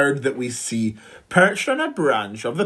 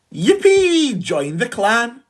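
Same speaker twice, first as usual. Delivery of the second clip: gleeful shout; 7 semitones higher, slower than usual. A narrator's reading. The three animals welcome the warthog with a gleeful shout. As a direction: whoop with joy. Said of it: "the other three shouted".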